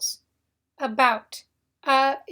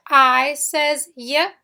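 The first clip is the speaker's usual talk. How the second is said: I says Y, slower and louder; slow, stressed; 6 dB louder, slower than usual. Shown as a demonstration. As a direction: stretch the sounds slowly. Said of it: "Notice Y".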